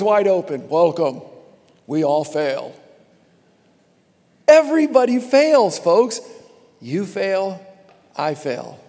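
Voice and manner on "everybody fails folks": loud proclamation. Real, not acted. Preacher proclaims to congregation.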